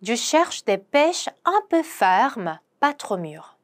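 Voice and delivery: very slow, careful French; slow pronunciation model; slow demo. Shown as a demonstration. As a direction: lay out each syllable slowly for learners. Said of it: "쭉 한번 발음을 해보도록 하겠습니다".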